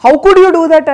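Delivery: indignant mock interrogation; accusing confrontation. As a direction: mimic critics grilling him. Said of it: "అని నిలదీసినట్టు అనిపించింది".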